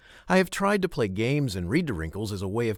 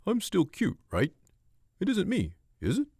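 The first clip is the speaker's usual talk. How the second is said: pleading dog voice; 8 semitones higher than usual. Retold or imitated. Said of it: "He seems to be asking".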